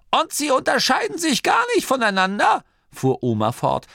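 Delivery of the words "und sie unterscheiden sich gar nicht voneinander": high-pitched indignant granny; complaining granny. A narrator's reading. (empörte, hohe Oma-Stimme)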